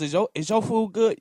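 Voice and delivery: high, fussy waiter voice; mock server voice. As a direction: play a pestering waiter, hammed-up. Mocking an overly attentive server.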